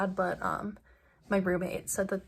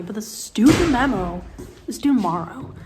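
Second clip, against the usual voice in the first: exasperated; 9 dB louder than usual. Real, not acted. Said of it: "I'm having a mental breakdown".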